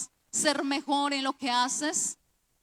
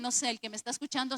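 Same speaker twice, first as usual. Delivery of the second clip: ordinary delivery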